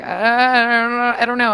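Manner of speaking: mock clueless dithering; retold stalling. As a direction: stretch the first words out like someone stumped. Imitates him stalling for an idea.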